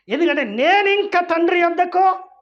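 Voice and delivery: preacher rising to a loud rebuke; fiery preaching. Acted. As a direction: build the rebuke up to a shout. Preaching outburst.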